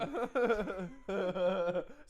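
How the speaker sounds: high cracking laugh, near tears; howling laugh. Real, not acted. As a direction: laugh till it breaks. Wild laugh at joke.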